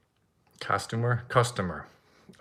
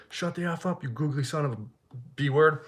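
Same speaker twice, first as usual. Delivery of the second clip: mildly voiced angry comeback, pitched up; held-back retort; 4 semitones higher, 3 dB quieter than usual. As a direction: act out an insult you wanted to yell back. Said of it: "she started yelling at me".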